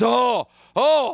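high mock cry of panic. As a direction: yelp like a kid who just got scared. Mimicking panicked child.

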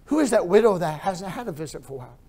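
ordinary delivery

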